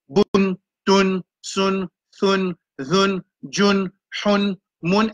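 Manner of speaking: each syllable spelled out clearly; modelled sounds. Shown as a demonstration. Teacher sounding out Arabic letters for the class, clipped and clear. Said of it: "c'est le son".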